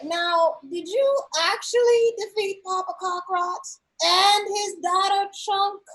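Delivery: high, slow, croaking crone; grotesque monster voice. Acted. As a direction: creak it out like a suspicious old goblin shaman. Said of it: "she's like".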